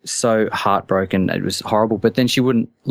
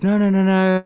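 higher-pitched retold whimpering protest; puppy-dog pleading; 9 semitones higher than usual. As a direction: imitate someone begging like a puppy dog. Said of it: "did the whole puppy dog".

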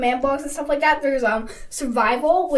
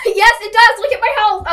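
excited shout; 7 semitones higher, 7 dB louder than usual. Real, not acted. Just hit by lightning.

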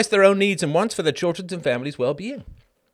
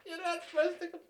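laughing; 9 semitones higher, 6 dB louder than usual. Real, not acted. Laughing repetition.

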